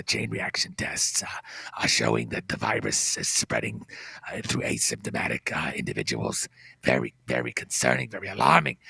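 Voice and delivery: very low, droning, detached expert; Fauci impression. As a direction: drone on flatly with long uhs. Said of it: "He says".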